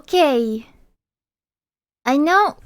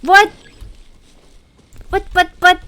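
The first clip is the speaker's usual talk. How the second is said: high, loud disbelieving what-what-what; shocked; 8 semitones higher, 10 dB louder than usual. Real, not acted. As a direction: blurt it out in shock. Shocked at game event.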